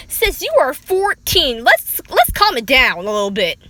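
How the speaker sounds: high, loud, slowed disbelief; exasperated. Real, not acted. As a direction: call her out, sassy and loud. Scolding the fourteen-year-old.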